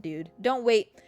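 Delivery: ordinary delivery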